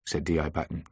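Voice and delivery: plain reading voice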